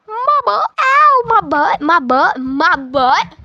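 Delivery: high, drawn-out "ow, my butt"; mock pained girl. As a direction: squeal it like a girl in comic pain. Imitating the girl landing in pain.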